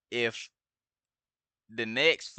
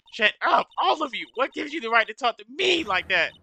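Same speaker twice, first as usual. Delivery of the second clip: shrill cornered witness; 8 semitones higher than usual. Acted. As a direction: snap back like a spoiled girl caught out. Witness April May snaps at lawyer.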